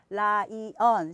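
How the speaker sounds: 라이언 slowly as three equal syllables; wrong syllable split. Shown as a demonstration. Mom teaching English by rote, 라이언 in three separate syllables. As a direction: chop 라이언 into 라, 이, 언. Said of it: "사실 이거는 다 틀린 발음이에요".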